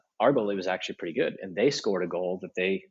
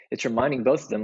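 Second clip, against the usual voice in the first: ordinary talk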